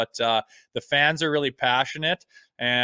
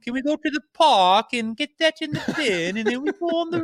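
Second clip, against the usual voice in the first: put-on British accent of a small child, pitched much higher; kid's British accent; 4 dB louder than usual. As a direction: mimic a four-year-old British boy, high and sweet. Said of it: "he was talking like".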